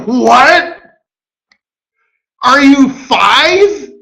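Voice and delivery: high, loud disbelief with a laugh; laughing, drawn-out mock astonishment; incredulous laugh. Real, not acted. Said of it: "speaker's own incredulity".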